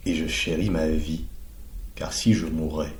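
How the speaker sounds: soft, hushed recital of a poem line, half-whispered on si je mourais; tender hushed recitation; poem reading. Acted. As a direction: let the verse sink in quietly. Reads quoted poem line.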